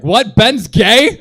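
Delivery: hammed-up disbelief; shocked. Friend hearing about Ben, mock shocked outburst.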